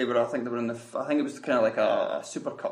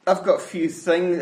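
ordinary talk, nothing marked

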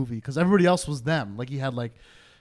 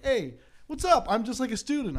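casual student character; 8 semitones higher, 5 dB quieter than usual. Acted. Imagining his part as just a student, casual character voice. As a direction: lightly play a harmless student, pitched way up.

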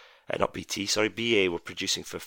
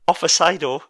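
Offsido said higher and louder in a mock Japanese accent; 7 semitones higher, 9 dB louder, slower than usual. Shown as a demonstration. Quiz host enjoying a favourite term, in a loud mock Japanese accent. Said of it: "my best Japanese accent".

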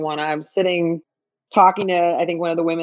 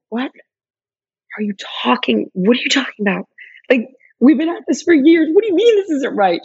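incredulous, rising higher as it goes; retold disbelief; 7 semitones higher than usual. Arguing with partner in their first fight, increasingly incredulous. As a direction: challenge your partner, building in pitch.

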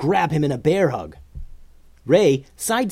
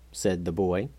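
plain reading voice

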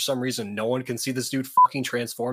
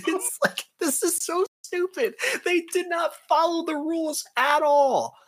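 high, incredulous complaining; exasperated mockery. Real, not acted. Host ranting about the show breaking rules, exasperated mockery. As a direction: sound fed up with the show's nonsense. Mocking the show's logic.

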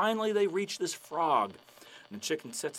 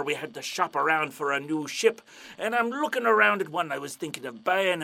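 pirate storyteller; 2 semitones higher, 8 dB louder than usual. Acted. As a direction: spin the tale like an old buccaneer. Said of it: "the pirate's like".

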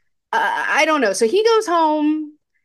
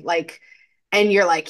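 normal talking voice

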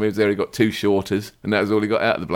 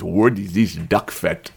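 slow mock Russian accent, English-style; "What is this duck fat?" in a hammed-up Russian accent; slower than usual. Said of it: "impersonation of an English person doing the Russian accent".

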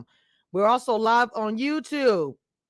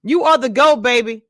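hype shout-out; 8 dB louder than usual. Real, not acted. Streamer hyping a guest, excited. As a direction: hype her up as the greatest.

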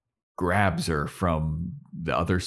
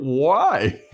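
loud, higher, exclaimed disbelief; all-caps WHY; 8 semitones higher, 7 dB louder than usual. Acted. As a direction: shout it like a note written in capitals. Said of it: "in all caps".

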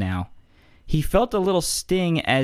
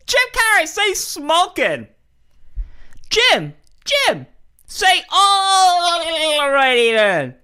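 high yelling fan voices; shouting fans; 6 dB louder, slower than usual. Retold or imitated. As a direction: holler at a celebrity. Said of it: "walk up to him and be like".

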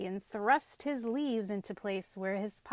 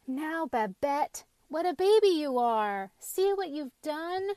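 loud, higher scolding cry; cried out; 6 semitones higher, 5 dB louder than usual. A narrator's reading. Brother to his sister over the fallen pumpkin, scolding cry. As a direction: exclaim it in exasperation, pitched up. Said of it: "cried Anton".

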